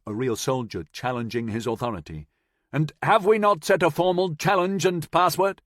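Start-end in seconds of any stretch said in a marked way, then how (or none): 2.7-5.6 obnoxious. A narrator's reading. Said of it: "how obnoxious he sounded".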